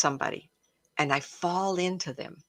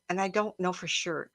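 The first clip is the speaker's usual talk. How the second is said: ordinary delivery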